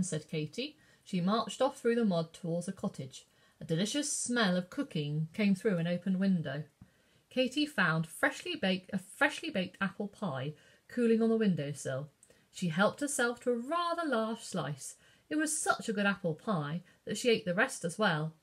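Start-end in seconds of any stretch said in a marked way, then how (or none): none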